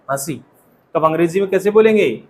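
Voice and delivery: usual speaking voice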